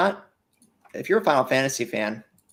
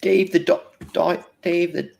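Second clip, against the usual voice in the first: put-on British accent; 5 semitones higher, 3 dB louder than usual. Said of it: "Not everything has to be said in a British accent".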